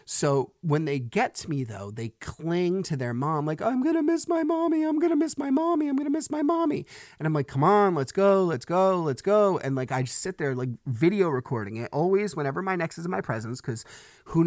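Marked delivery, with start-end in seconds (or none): 3.6-6.9 put-on high, whiny little kid clinging on; whining child. Dad imitating his kids at pickup, high whiny little-kid wail. 7.5-9.6 louder, insistent hurry-up; urging kids. Retold or imitated. Dad hurrying his crying kids to the car, louder and insistent.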